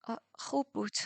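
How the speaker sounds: a dry throat forcing out the words; nervous husky reply; hoarse voice. Acted. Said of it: "با صدای گرفته گفتم".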